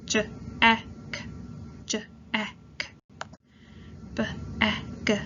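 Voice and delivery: segmented sounds, one by one; sounding out words. Shown as a demonstration. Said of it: "I'm going to say sounds for you to blend into words".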